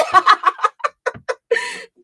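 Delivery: long, high-pitched cackle; high loud laugh. Real, not acted. Streamer laughing after vowing to read, high cackle.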